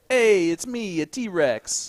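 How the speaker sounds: loud, high cartoon dinosaur voice; T-Rex voice. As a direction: bellow it like a goofy T-Rex. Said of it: "Unless it's".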